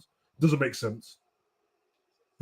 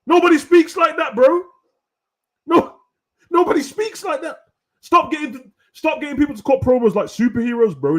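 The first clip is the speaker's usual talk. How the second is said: 1 exasperated rant; 11 semitones higher, 5 dB louder than usual. Real, not acted. Ranting loudly at bookers.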